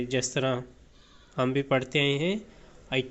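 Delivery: ordinary delivery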